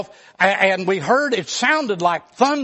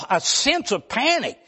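ordinary talk

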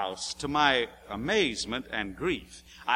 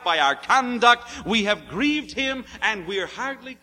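louder and higher, driving the point home; preaching at full force; 6 semitones higher, 4 dB louder than usual. Acted. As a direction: preach it hard, "by our conduct" slowed. Preaching climax on grieving the Spirit.

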